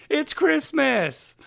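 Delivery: high, slow mock squeal; screaming little girl. Host repeating what the little girl says, high drawn-out mock cry. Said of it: "she just points and goes".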